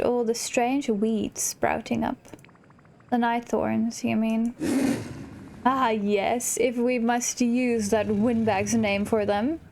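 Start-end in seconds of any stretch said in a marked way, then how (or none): none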